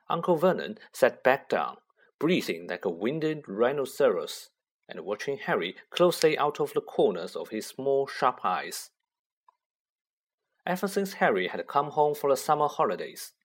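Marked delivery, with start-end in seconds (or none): none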